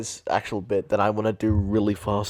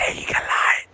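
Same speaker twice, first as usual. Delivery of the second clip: unvoiced Toad whisper; 3 dB louder than usual. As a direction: whisper it hoarsely. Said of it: "I'm trying to whisper".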